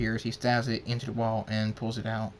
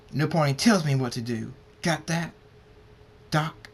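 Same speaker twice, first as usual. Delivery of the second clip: raised, forceful warning; heated, higher-pitched demand; threatening; 4 semitones higher, 5 dB louder than usual. Acted. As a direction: make it a challenge. Punches him, threatens.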